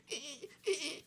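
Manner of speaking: high, squeaky repeated chirps; imitated cricket chirp. Keeper imitating the cricket heard all night, squeaky chirping. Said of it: "all I hear is that".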